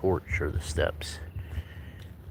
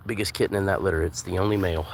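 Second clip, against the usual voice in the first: ordinary delivery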